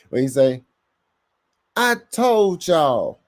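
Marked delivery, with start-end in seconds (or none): 1.8-3.2 high, drawn-out catchphrase holler; slow, loud mock bellow, pitched way up; Mad Mike. Host mimics Mad Mike for the chat, high drawn-out holler. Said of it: "in my Mad Mike voice".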